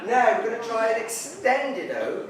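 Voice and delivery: normal talking voice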